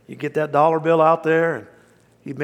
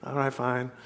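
resigned muttering; 2 semitones lower, 8 dB quieter than usual. Retold or imitated.

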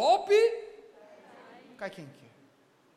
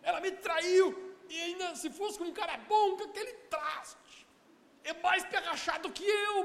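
desperate outburst; 6 semitones higher, 4 dB louder than usual. Retold or imitated.

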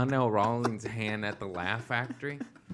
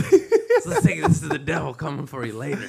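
menacing taunt; 9 dB louder than usual. Retold or imitated. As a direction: threaten them like the devil himself. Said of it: "I'd be like".

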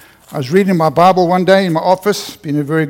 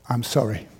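softer, lower apology; quiet sorry; 4 semitones lower, 7 dB quieter than usual. Retold or imitated. Husband apologizing to his weeping wife, softly. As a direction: drop it low and plain.

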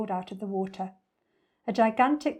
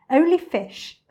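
firm objection; 8 semitones higher, 8 dB louder than usual. Acted. Not-so-big crocodile objects to eating children.

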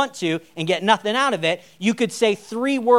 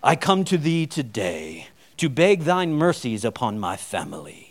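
much lower, solemn churchy voice; mock solemn prayer voice, much lower; dropped pious voice; 8 semitones lower than usual. Shown as a demonstration. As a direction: drop the voice into mock King James piety. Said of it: "you don't have to drop your voice like".

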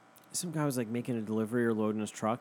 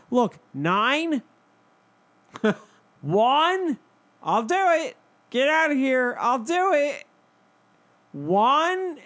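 much higher, louder put-on voice; mock countdown; 12 semitones higher, 7 dB louder, slower than usual. The man counting down at the lady, squeaky mock. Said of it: "he's like".

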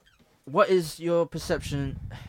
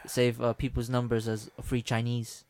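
ordinary talk

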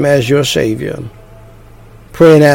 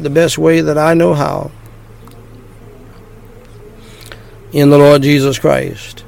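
short phrases with pauses. Shown as a demonstration. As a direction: pause after each phrase for repeating. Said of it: "phrase by phrase".

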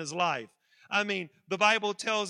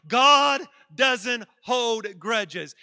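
much louder and very slow "God doesn't hold grudges"; loud slow call; 2 semitones higher, 9 dB louder, much slower than usual. Shown as a demonstration. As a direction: lead the crowd in a stretched-out shout. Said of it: "fifty people in this building say".

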